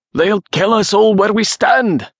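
high-pitched, urgent cry over battle; desperate shout. A narrator's reading. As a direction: cry out in alarm to a comrade. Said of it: "Coinach shouted to Rory".

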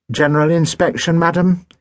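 a smarmy barber fishing for approval; greasy barber. A narrator's reading. Barber to the mother, greasy and fawning. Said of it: "he said greasily".

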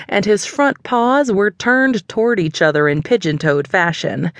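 ordinary delivery, nothing marked

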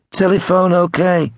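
high-pitched Liverpudlian caller. A narrator's reading. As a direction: quiz them in a high Liverpudlian accent. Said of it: "in his best Liverpudlian".

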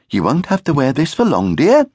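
high, gentle motherly voice; kindly. Acted. Mother straightens his patch, kind and high. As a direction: reassure your little one. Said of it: "she said kindly".

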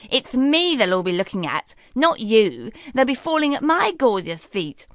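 mocking stepsister, lightly voiced; scornful Miranda. Miranda mocks Daphne before the ball, lightly scornful. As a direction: gloat over her sister, dismissive. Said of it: "snorted Miranda".

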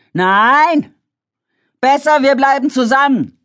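high, loud objection; protesting shout. A narrator's reading. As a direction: push back against the plan. David protests loudly.

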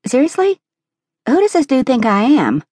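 high indignant disbelief; appalled, pitch soaring; outraged. A narrator's reading. Outraged reaction.

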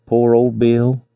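mournful. A narrator's reading. Givens over the dead lion, mildly mournful. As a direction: mourn it with understated sorrow. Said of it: "he exclaimed mournfully".

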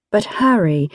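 ordinary delivery, nothing marked

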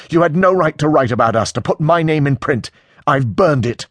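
high, heated accusation; seeping anger. A narrator's reading. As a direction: lash out, meaning to hurt. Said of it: "Anger seeps out of him".

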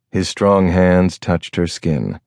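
usual speaking voice

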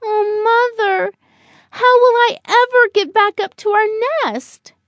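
high, plaintive little-bird voice; worried young bird. Acted. Buddy looking up at his nest, worried and pleading. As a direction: plead up high like an anxious child.

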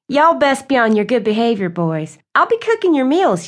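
put-on tease-warn the boys, lifting the pitch late; sassy cook to the boys.

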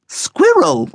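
high, sharp correction of a word; high-voiced pedantic correction; correcting. A narrator's reading. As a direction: correct him in a high-pitched squeak. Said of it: "Coco corrected him".

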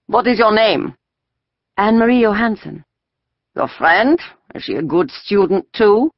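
stern soldier questioning; a soldier interrogating children. A narrator's reading.

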